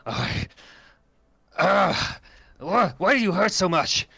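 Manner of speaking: high, strained groan of agony; werewolf in pain. Acted. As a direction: cry out at a wound that won't stop hurting.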